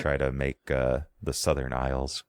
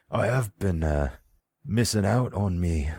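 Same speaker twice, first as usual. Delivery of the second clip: a touch of a different servant voice; grumbling servant; 3 semitones higher than usual. Acted. Servant Ollie complaining to his boss about sleep.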